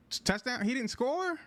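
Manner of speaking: can't believe he didn't score; stunned disbelief. Real, not acted. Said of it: "voice crack on a big play".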